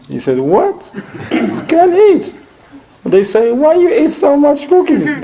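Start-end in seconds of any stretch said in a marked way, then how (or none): 0.4-2.4 high, slow, laughing disbelief; laughing incredulous. Retold or imitated. Guest telling hosts he can't eat, laughing high. 3.5-5.2 high squeaky laughing question; laughing mock scolding. Hosts teasing guest about the cookies, laughing high.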